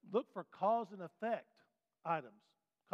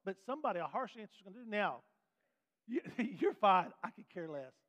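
ordinary delivery

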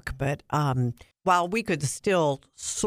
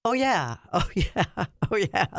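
higher, vague cheerful yes-yes; mom agreeing; 5 semitones higher than usual. Retold or imitated. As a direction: agree absent-mindedly as old mom. S1 voices mom's agreeing.

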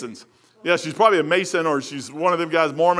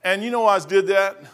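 usual speaking voice

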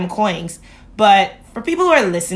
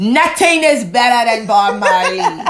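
slow, loud laughing gush; 3 semitones higher, 7 dB louder, slower than usual. Real, not acted. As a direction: savour the name through a laugh. Gushing about [name] coffee.

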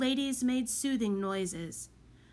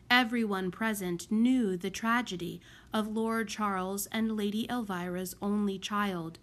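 usual speaking voice

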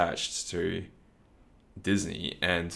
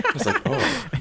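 mock dismay; 3 dB louder than usual. Retells realization with high voice.

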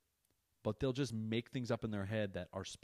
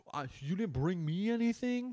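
put-on high whiny child voice, much higher; jealous kid; 10 semitones higher, 4 dB louder than usual. Son jealous of his sister's sleepover gifts, high whiny kid voice. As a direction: mimic a sulky little boy begging. Son whines about no gift.

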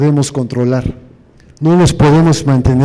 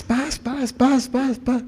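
high, soft mock punches; punch sound effects; 8 semitones higher, 10 dB quieter, much slower than usual. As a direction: tap out the blows with your voice. Imitating punches being thrown. (golpes imitados con la voz, agudos y suaves)

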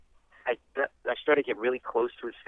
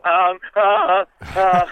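stammering; 7 semitones higher, 8 dB louder, much slower than usual. Retold or imitated. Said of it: "I started to stammer".

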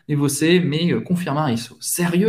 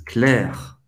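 ordinary talk

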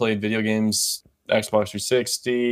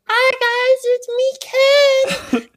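put-on very high squeaky kid voice; squeaky teen voice; 5 dB louder, much slower than usual. Recalling his teen YouTube intro to a friend, squeaky high voice. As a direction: squeak it out like a fourteen-year-old YouTuber. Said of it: "I had the squeakiest voice".

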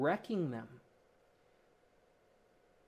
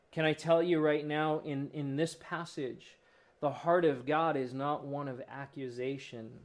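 ordinary talk, nothing marked

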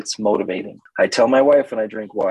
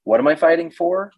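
confused client; 7 semitones higher than usual. Retold or imitated.

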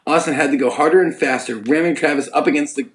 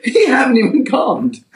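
slow and higher, through laughs; laughing; 8 semitones higher, 3 dB louder, slower than usual. Real, not acted. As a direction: stumble over it while laughing. Laughing misreading.

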